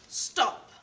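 sharp loud stop; loud call. Acted. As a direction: shout it to halt them. Story character calls out.

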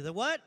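"what" pitched high and loud, rising; mock not hearing. Pastor pretending he didn't hear congregation, "what" sharply rising. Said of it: "I'm sorry, I didn't hear you".

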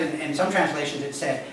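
ordinary talk

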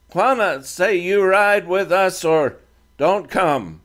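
put-on a defiant teen speaking halting English; Comanche boy leader.

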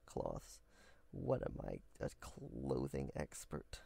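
low, soft self-mocking aside; muttered aside. Real, not acted. Creator mocking own word choice, low mutter. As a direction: mutter it under your breath at yourself.

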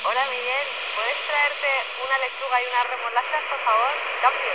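tinny voice coming out of the handset speaker; walkie-talkie radio voice. Real, not acted. [name]'s call heard on Miguel's walkie-talkie, radio sound. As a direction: sound like it's crackling through a walkie-talkie.

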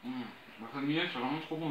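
nibbling on chicken bone; chewing while talking. Real, not acted. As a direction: mouth partly full of food. Seen 1: Nibbles chicken wing at mouth while speaking; lips greasy, chewing.

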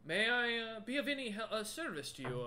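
high, loud, eager-to-serve troll; courteous troll shopkeeper. Acted. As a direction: offer help as an obliging troll merchant. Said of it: "looks at the rest of you and goes".

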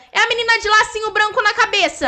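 normal talking voice

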